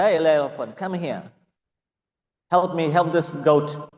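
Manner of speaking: lion king ordering the elephant; calling out. Acted. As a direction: call the elephant over with authority.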